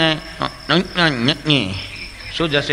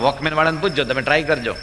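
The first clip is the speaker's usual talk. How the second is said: ordinary delivery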